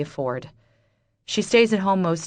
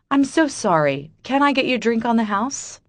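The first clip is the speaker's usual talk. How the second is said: higher, bright forced politeness; forced cheerful voice; 6 semitones higher, 3 dB louder than usual. Acted. Waitress faking cheer to the rude customer, forced cheerful. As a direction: put on customer-service cheer. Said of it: "say in my best cheerful voice".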